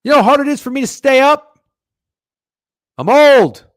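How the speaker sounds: high, loud complaint; exasperated shout. Real, not acted. Tired older fan on staying up late, exasperated shout. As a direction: yell it in aggrieved protest.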